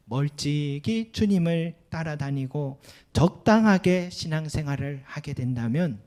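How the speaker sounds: ordinary talk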